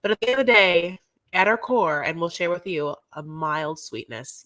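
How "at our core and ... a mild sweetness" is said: ordinary delivery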